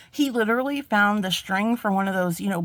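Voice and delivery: ordinary talk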